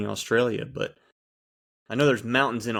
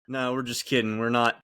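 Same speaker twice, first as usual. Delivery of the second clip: ordinary talk, nothing marked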